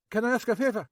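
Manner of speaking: Max. A narrator's reading. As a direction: play Max high and jittery. Max voiced.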